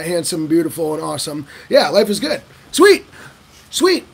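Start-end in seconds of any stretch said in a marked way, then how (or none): 2.7-4.1 high, loud little cheer; excited cheer. Real, not acted. Speaker cheering how easy it is, high and excited. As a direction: celebrate it brightly.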